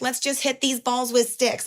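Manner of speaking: mock mimic of a dismissive kid. Mocking young people who ridicule exercise classes. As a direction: sneer it like a snarky twenty-year-old.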